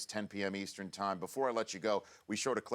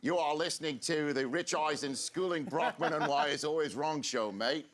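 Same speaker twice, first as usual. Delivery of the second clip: hammed-up Australian accent, pitched higher; 6 semitones higher, 4 dB louder than usual. Shown as a demonstration. Host egged on to mimic an Aussie, mock Australian accent. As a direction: do a bad, lively Australian accent. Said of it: "you do it in an Australian".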